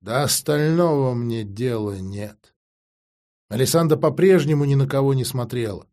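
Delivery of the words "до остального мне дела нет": usual speaking voice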